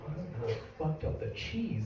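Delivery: very soft, baffled echo; bewildered muttering. Acted. Manager puzzles over customer's complaint, bewildered murmur. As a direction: mumble it back under your breath.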